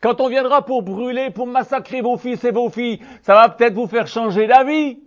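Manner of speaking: high, loud, fiery warning; a preacher raising his voice against the comfortable; loud preaching warning. Acted. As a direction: thunder it at the complacent. Preacher warns scoffers.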